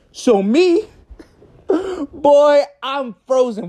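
usual speaking voice

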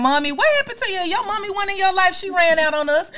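high, lightly voiced kid asking; imitating a child. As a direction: ask like a puzzled little kid. Voicing her child later.

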